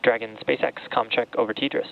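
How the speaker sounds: crackly radio comm check; astronaut radio. Real, not acted. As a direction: speak as if over a headset radio.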